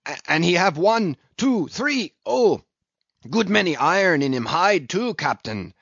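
high, halting harpooner in broken English; loud, broken-up exclamation; disjointed. A narrator's reading. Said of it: "cried Queequeg disjointedly".

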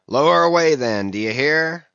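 high, loud shout across a deck; shouted order. A narrator's reading. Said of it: "shouting across the deck".

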